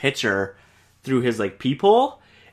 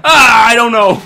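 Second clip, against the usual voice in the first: higher, louder laugh-talk; 11 semitones higher, 11 dB louder than usual. Real, not acted. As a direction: squeal it through laughter. Laughing at friend choking.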